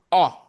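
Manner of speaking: "O" as an open aw; o grave sound. Shown as a demonstration. Teacher shows class the o grave sound. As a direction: model "O" as open aw for repeating. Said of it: "gives you the sound".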